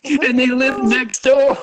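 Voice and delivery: high, drawn-out mock lament; mock distraught. Lamenting that they live next door, mock distraught. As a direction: wail it slowly, hamming up the misery. Acted breakup meltdown continues.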